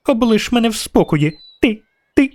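put-on very high child voice in a temper; indignant little girl. A narrator's reading. Caught girl tells witcher off, indignant high voice. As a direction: protest like a cornered child, high-pitched.

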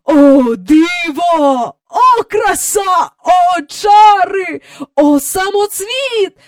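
high, loud, slow swooning cries; rapturous exclaiming. A narrator's reading. Mister Banks marvelling through the telescope, loud drawn-out rapture. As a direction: stretch each word out in wonder.

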